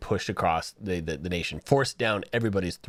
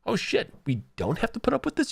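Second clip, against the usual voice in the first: amazed students; 12 semitones higher than usual. Retold or imitated. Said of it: "was like".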